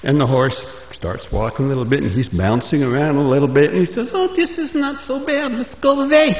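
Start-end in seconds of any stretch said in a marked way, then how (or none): 4.1-6.4 much higher, gleeful voice; enjoy the gentle bounce; delighted rider. Acted. Moshe on a walking horse, much higher and gleeful.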